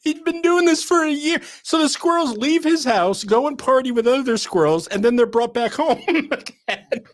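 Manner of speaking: high, excited voice breaking into laughter; laughing punchline. Real, not acted. Squirrel storyteller lands the punchline for listeners, high and laughing. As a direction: deliver it high, laughter taking over by the end.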